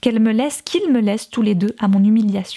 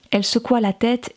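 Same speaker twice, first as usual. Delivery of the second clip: plain reading voice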